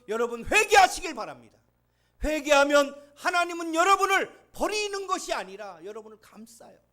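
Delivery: loud, high, impassioned sermon cry; fervent pulpit shouting; full-force preaching. Acted. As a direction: preach it out to the whole congregation.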